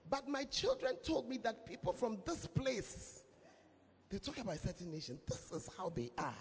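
put-on soft, hushed aside to a young friend; preacher hushing a warning to a friend; confiding advice. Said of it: "And I told him that".

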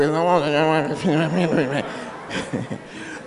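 low amused chuckle. Real, not acted. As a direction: laugh it off low. Laughs at own remark.

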